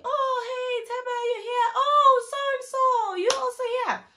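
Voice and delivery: high-pitched fake surprise; staged surprised greeting. Promoter pretending he just bumped into the friends, staged surprise. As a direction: squeal as if it's a chance meeting. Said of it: "like you just saw me".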